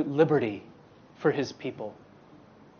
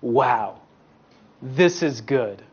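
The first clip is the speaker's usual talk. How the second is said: lower, slow, awed wow; awed creator; 6 semitones lower, slower than usual. Acted. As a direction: savour it like a proud maker. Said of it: "he stepped back and he said".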